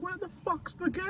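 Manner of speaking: high, distant, fading cry; far-off call. Retold or imitated. Sam calling out as he's dragged away, faint and far off. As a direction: sound like you're being carried off into the distance. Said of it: "Sam saying as he's going off in the distance".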